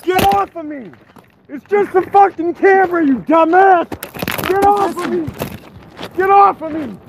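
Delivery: high desperate screams; panicked screaming. Real, not acted. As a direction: scream it, struggling and scared. Being held down, punched.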